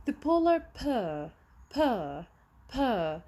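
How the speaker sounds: "bear" with the final R barely there, drawn out; barely sounded final R. Shown as a demonstration. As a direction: model "bear", letting the R fade almost to nothing. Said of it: "لاحظوا هون ال R يا دوب صوتها طالع".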